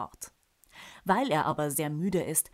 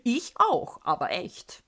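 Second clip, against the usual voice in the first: giggling; 6 semitones higher, 6 dB louder than usual. Acted. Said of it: "kichert Onkel Hans".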